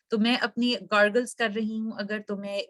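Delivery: usual speaking voice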